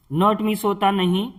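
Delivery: normal talking voice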